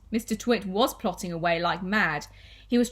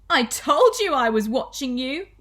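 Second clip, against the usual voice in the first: lightly cackling wife, higher and louder; cackling gloat; 5 semitones higher, 7 dB louder than usual. Acted. Wife caught husband with her glass eye, cackling gloat. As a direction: crow it loudly in triumph. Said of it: "cackled Missus Twit".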